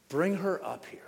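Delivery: whispered, weary order; worn-down judge. Acted. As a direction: whisper it as if at breaking point. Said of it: "he finally comes to his breaking point".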